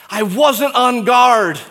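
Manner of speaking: high, pained second plea; anguished repeat. Pretend. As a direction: let the regret break out. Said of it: "say to us by way of explanation".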